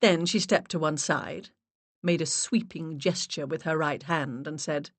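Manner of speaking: ordinary delivery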